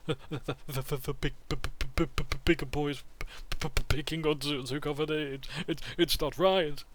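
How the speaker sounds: put-on high, stuttering frightened child; stammering. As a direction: stammer it out nervously. Scared kid stammers.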